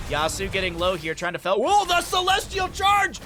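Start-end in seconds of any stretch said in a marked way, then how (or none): 1.6-3.2 much higher, louder, slow whoa; excited yell. Real, not acted. Caster yelling at the Celestial Charge play.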